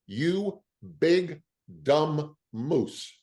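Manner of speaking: slow, high-pitched retold insult; mock scolding. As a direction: spell out the mock insult word by word. Rickles scolds him after the call.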